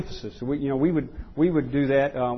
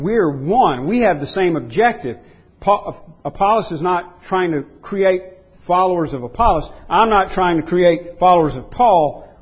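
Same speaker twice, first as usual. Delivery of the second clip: raised, forceful pulpit voice; emphatic preaching; 5 semitones higher, 6 dB louder than usual. Real, not acted. Preacher explaining Paul's shared objective with Apollos, emphatic preaching. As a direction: drive home each clause with a higher pitch.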